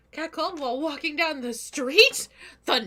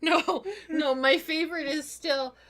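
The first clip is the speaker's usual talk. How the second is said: normal talking voice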